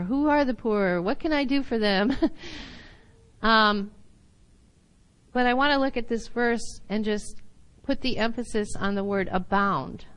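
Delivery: usual speaking voice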